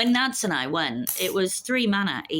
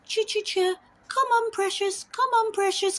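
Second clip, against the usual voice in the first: much higher, sing-song cat-coaxing voice. Retold or imitated. Waiter coaxes cat out from under customer's chair, high and cooing. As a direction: coo sweetly to lure the cat away.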